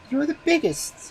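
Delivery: put-on very high baby voice; a child naming his bones. A narrator's reading. Baby naming the magic bones, very high.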